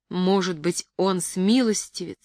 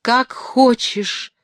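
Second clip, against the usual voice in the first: slow, louder and higher cry; exclaiming; 6 semitones higher, 5 dB louder, much slower than usual. A narrator's reading. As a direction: cry it out slowly, giving in completely. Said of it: "воскликнул он".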